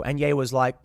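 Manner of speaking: ordinary talk, nothing marked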